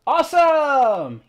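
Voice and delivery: high, loud, slow cheer; excited cheer. Real, not acted. Player cheering as the barrier breaks. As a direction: cheer it out with joy.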